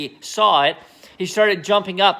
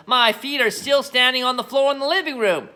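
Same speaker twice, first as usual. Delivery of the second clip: put-on gleeful old woman; 7 semitones higher than usual. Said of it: "cackled the old woman".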